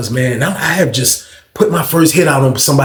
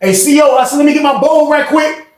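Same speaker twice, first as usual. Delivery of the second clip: higher, raised call to the guard; 10 semitones higher than usual. Retold or imitated. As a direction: call across the pod casually. Calling out to the guard.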